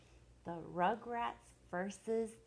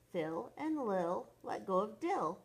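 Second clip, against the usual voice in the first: ordinary delivery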